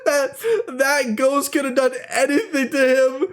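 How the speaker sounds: high, giggling admission; laughing confession. Real, not acted. Telling viewers she left her brother, laughing high. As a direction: confess it while cracking up. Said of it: "laughing through confession".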